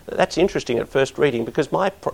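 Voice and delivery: ordinary talk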